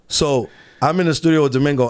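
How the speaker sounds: ordinary talk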